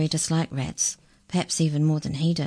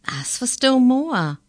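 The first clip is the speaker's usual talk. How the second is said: laughing; 9 semitones higher, 6 dB louder, slower than usual. A narrator's reading. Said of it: "laughed Maury".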